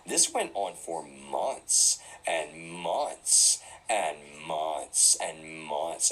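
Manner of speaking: very slow, drawn-out repeats of "months"; low, slow, weighty emphasis on every "months"; emphatic. Real, not acted. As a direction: drag out each "months" to stress how long. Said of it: "Because I wanna emphasize".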